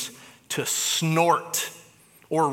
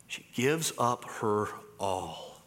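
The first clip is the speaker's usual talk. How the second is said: hushed and slow; 7 semitones lower, 7 dB quieter, slower than usual. Real, not acted. Climax of sermon point.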